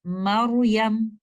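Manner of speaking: मरियम very slowly with a thick, heavy र; slow Quranic मरियम with full tafkheem. Shown as a demonstration. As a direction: draw out मरियम, raising the back of the tongue on र. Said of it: "heavy या तफखीम के साथ पढ़ेंगे".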